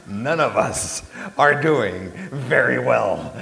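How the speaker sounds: loud, slow, emphatic retort; retold exasperated outburst; half-upset retort. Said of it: "I was just half upset".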